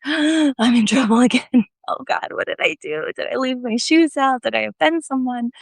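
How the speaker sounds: gasping, worried self-questioning; mock panic. Hears a late knock at the monastery, mock panic. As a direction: act out a fretful rush of guilty questions.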